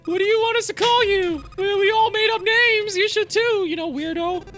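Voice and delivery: put-on high, fast, teasing young girl; a pushy girl, pitched well up. S2 voices Clover throughout.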